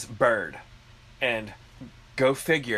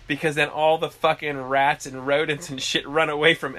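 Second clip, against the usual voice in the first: ordinary talk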